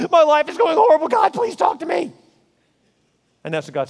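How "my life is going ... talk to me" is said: loud, high shouted plea; desperate cry. Pretend. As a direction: cry out to God as life falls apart. Mock desperate prayer.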